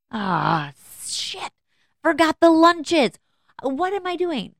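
high, alarmed cry of dismay, then a stretched-out groan; a mom realizing mid-drive she forgot the lunches, panicked; panicked outburst. Retold or imitated.

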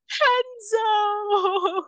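long, high wailing of the name; mock anguished call. Amber kneeling by the stabbed brother, high wail. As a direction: call out to him in despair. Heroine calls wounded brother.

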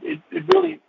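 fighting back tears while speaking; emotional, voice breaking. Real, not acted. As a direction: voice catching high with real emotion. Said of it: "I'm so emotional right now".

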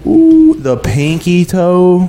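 high pained ooh, then dragged out slowly; mock wince. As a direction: wince it like you just stubbed your toe. Wincing at stubbed toe.

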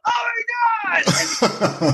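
high-pitched mock shriek with laughter; crowd going wild. Crowd reacting to the contestant, high mock shriek. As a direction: imitate an astonished, screaming crowd. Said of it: "And the crowd went".

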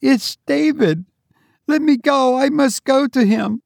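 high frantic pleading; desperate plea. Acted. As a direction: beg to go. Mother pleading to go.

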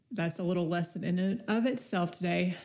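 usual speaking voice

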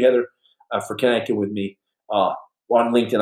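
ordinary talk

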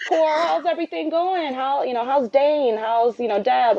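high, cheery small-talk voice of the doctor; chatty doctor. Retold or imitated. Doctor making small talk with patient, high and cheery.

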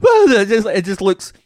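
high, loud hammed-up startled cry; mock heroine. Imitating the actress on a soundstage, high hammed-up cry. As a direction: squeak like a badly acted heroine. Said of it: "her in the just going".

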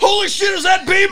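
high, loud excited recognition; surprised shout. Retold or imitated. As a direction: yell it on spotting him. Spotting him at the bank.

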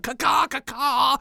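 high slow caw; parrot squawk. Pretend. Boy in parrot costume.

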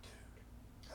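the word barely makes it out, choked; emotional. Real, not acted. Telling a friend's crisis, whispering through tears. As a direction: voice gives out into a whisper. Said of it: "I'm trying not to get emotional".

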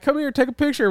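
mock high coaxing trophy-girl voice; imitating trophy girl. Retelling the trophy girl, mock high coaxing voice. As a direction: lightly play the girl luring him into a photo. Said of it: "she was trying to be like".

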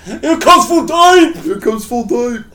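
slow, loud announcer call, laughing; sports announcer. Pretend.

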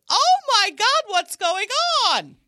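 very high, loud shrieking mom; frantic mom. Acted. Improviser showing off the mom character, shrill panicked shout. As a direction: shriek it like an alarmed mother. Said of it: "I do the mom who's like".

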